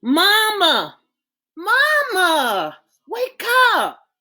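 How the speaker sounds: high, loud, panicked calls; a girl screaming for her mother in the night; frightened screaming. Acted. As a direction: shake her awake in fear. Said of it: "Camille screamed".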